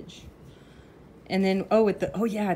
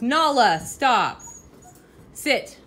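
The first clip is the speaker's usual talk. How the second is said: firm raised voice at the dog; sharp commands to a misbehaving dog; loud dog-owner commands; scolding the dog; 5 semitones higher, 8 dB louder, much slower than usual. Real, not acted.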